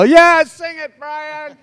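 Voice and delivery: high, loud fan cheer; cheering crowd. Retold or imitated. Audience cheering on Brian. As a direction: whoop it up like an excited audience. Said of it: "everybody's, like".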